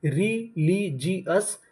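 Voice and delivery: Religious stretched out very slowly with a rising end; slow drawn-out model word. Shown as a demonstration. Teacher to students, slowly modelling religious with a rise. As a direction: draw out religious syllable by syllable, rising. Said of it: "तो पूरी meaning क्या बनी?".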